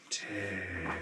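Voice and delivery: soft, faraway ghost murmuring his name; ghostly murmur. Acted. Game master voicing the dazed ghost Terrence, soft murmur. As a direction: let the name drift out, lost.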